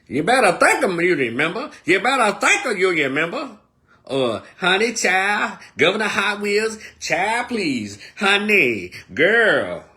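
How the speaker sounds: high, loud sing-song sass; mock Keisha-girl cosplay. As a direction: exaggerate a sassy girlfriend act, swooping up high.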